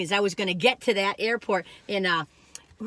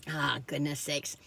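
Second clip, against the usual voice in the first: ordinary talk, nothing marked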